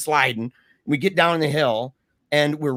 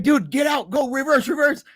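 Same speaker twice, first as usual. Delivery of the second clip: high frantic shouts; panicked shouting; 12 semitones higher than usual. Retold or imitated. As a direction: bark it at the driver. Urging driver in panic.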